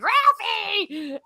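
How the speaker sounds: high scandalized yelp of a name; shocked mom call. Retold or imitated. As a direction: call out Ralphie in sudden shock. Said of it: "his mother's like".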